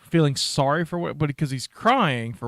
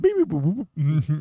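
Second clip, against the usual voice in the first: robot beeps. Retold or imitated. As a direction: chirp it like a droid. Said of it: "That's what that robot said".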